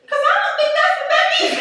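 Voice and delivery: very high, fast laughing doubt; skeptical. Retold or imitated. Wife doubting the ram is affectionate, squeaky disbelief. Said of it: "I was like".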